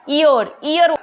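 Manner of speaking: ईयर with a Y-sound at the start; sounding out year. Shown as a demonstration. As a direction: say ईयर with a Y glide at the start, like yeer. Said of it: "हम बोलते time तो उसको ईयर बोलते हैं".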